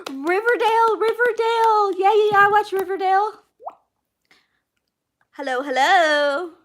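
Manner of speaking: high, loud burst of delight; excited shout. Real, not acted. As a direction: shriek it out with sudden joy. Suddenly recognises a show.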